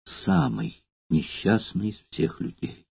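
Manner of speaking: slow, soft, heavy with sorrow; sinking into hopeless grief; deep grief. A narrator's reading. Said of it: "голосом, в котором звучало глубокое горе". (голосом глубокого горя)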